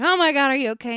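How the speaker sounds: loud, high alarmed outburst; retold alarm. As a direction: cry out in alarm for the vet tech. Retold alarm after dog bit ladle.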